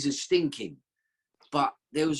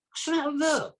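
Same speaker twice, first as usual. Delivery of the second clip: higher, incredulous question; baffled indignation; 11 semitones higher than usual. Retold or imitated. To his brothers after the nurse's outburst, baffled and higher. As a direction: thrown by the rude nurse.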